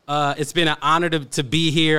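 lightly held radio host voice. Shown as a demonstration. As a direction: wind down the DJ intro. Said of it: "I don't use the radio voice often".

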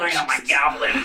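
high, cackling goblin; squeaky little goblin voice. Pretend. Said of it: "that's such a good goblin voice".

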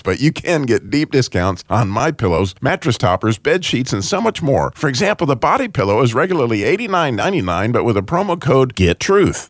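upbeat radio ad read. Acted. Host reading a sponsor ad, upbeat ad voice. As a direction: sell it like a sponsor spot.

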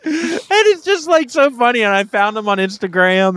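high, squeaky talk through laughter; cracking up while telling it; laughing hard. Real, not acted. Laughing at own embarrassing search story.

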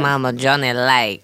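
low, soft flirting voice; flirty purr. Acted. Mama Johnny flirts with the caller, low and purring. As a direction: purr it seductively down the line.

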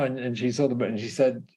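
ordinary talk, nothing marked

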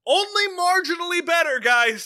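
sing-song sarcastic show moral; mock announcer voice. Mocking show's message voice.

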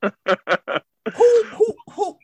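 laughing, high indignant who; laughing outrage. Real, not acted. Podcaster laughing at the missing song, high indignant cry.

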